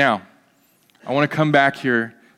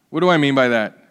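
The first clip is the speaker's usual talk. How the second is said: ordinary delivery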